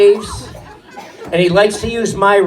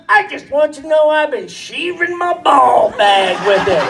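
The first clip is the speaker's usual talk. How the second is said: yelled punchline; 7 semitones higher than usual. Retold or imitated. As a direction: blurt it out to him.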